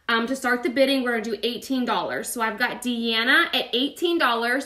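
ordinary delivery

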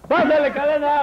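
high hailing call. Acted. Calling guest onto stage.

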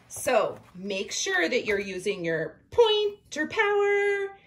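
high, loud pep for pointer power; excited cheer. Real, not acted. Teacher hyping her young readers, high and loud.

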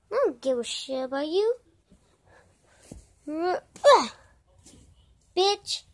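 ordinary talk

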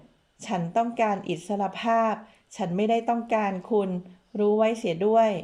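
ordinary delivery, nothing marked

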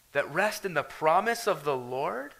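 normal talking voice

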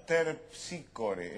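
"Terpsícore" very slowly, sounding both P and S; pronunciation demo. Shown as a demonstration. Colleague showing how he pronounces it, careful slow "Terpsícore". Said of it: "Fíjese cómo lo pronuncio yo".

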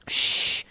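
sharp hushing "shh"; harsh shush. Acted. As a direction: hush her harshly. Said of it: "You hushed her harshly".

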